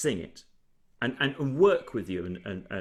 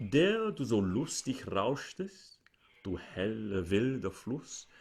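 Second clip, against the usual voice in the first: flat, slow, emotionless delivery; no emotion; 4 semitones lower, slower than usual. Shown as a demonstration. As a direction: say it with no feeling at all. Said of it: "I try and sing that with no emotion whatsoever".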